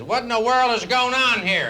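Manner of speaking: high, loud, outraged husband; shocked outrage. Acted. Husband finds his wife with his best friend, outraged. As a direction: yell it in comic indignation.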